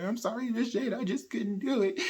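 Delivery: mild imitated tearful plea; crying apology. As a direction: sob it out lightly. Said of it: "he starts crying and breaking down".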